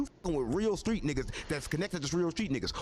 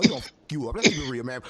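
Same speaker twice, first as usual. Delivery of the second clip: louder, pressing the warning home; 7 semitones lower, 7 dB louder than usual. Real, not acted. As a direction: lean in hard and loud. Warning about Four Extra's threat.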